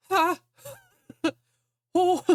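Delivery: high burst of laughter; laughing. Real, not acted. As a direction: crack up laughing. Laughing at own description.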